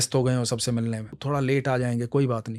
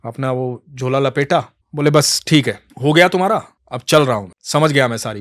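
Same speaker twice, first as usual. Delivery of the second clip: louder, huffy retort; flaring up; 6 dB louder than usual. Acted. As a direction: brush him off angrily. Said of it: "तो कृष्णदास भड़क गए".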